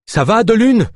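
high, loud anxious call; shouting. Acted. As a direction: shout over the water. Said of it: "cria Naya".

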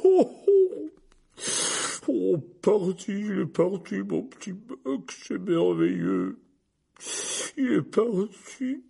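high, slow wailing, joy through tears; sobbing words. A narrator's reading. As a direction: sob it out loudly, voice swooping. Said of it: "ses paroles à travers ses sanglots". (sanglots de joie, voix qui déraille)

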